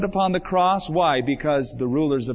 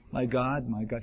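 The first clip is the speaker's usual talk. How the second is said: hushed cry; 4 semitones lower, 7 dB quieter than usual. Acted. Quoting Jesus's cry, softly.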